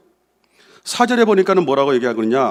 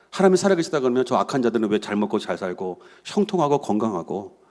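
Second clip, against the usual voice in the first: low, subdued musing, imitating a shaken believer; troubled doubt; 7 semitones lower, 3 dB quieter than usual. The psalmist questions why the wicked prosper, in low troubled doubt. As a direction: voice the doubts privately, pitched well down. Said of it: "심각하게 시편 기자가 고민을".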